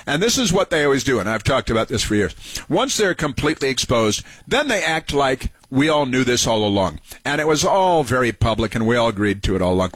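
ordinary talk

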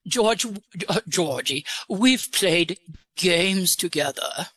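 put-on disguise the voice with an English accent. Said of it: "Where did you get your English accent?".

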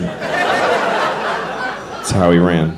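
whispered very slowly, "That's how he ran." drawn out; slow unvoiced whisper. Shown as a demonstration. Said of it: "It's an apt description".

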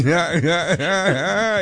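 usual speaking voice

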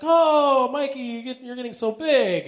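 high-pitched grandma impression; doting grandma. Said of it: "my grandma says the same thing".

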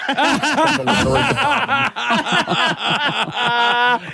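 long high laugh. Real, not acted. Caller laughing after telling the radio hosts his prank. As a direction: crack up laughing.

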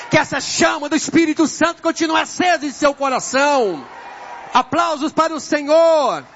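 loud, shouted blessing over the congregation; roaring pulpit exhortation; full-force preaching. Real, not acted. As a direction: proclaim it fervently at the top of the voice.

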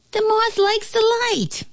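very high squeaky flea voice; echoing flea. A narrator's reading. Flea echoing the moth, squeaky voice. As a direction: parrot it back in a tiny squeak. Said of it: "echoed the flea".

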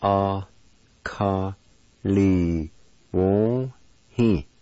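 "A ka li wo hi" with a pause after every syllable; syllable-by-syllable demo. Shown as a demonstration. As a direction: sound out "A ka li wo hi" slowly. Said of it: "It's pronounced like this".

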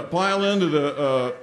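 ordinary delivery

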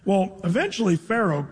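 ordinary delivery

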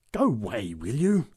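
mildly growled warning; a touch of gruff impatience. A narrator's reading. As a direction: growl at him to clear off. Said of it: "growled the big woman".

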